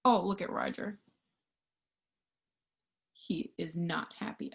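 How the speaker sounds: plain reading voice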